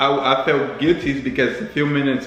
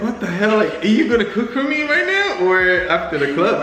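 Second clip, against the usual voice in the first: high-pitched, amused incredulous quote; retold disbelief; 7 semitones higher than usual. Friend mimicking a woman's baffled reply at the club, amused disbelief. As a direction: act out a baffled "what the hell" with laughter creeping in.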